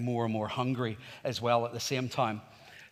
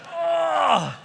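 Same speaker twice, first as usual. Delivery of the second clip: loud, high exasperated cry; frustrated groan; 13 semitones higher, 6 dB louder than usual. Retold or imitated. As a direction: let out a hammed-up wail of frustration. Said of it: "I was so frustrated".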